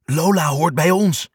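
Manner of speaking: plain reading voice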